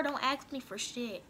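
normal talking voice

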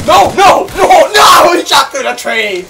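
high, loud string of protests easing off; panicked gamer's protest; real outraged yelling. Real, not acted. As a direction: yell in disbelief at being shot. Shot in the game, protesting.

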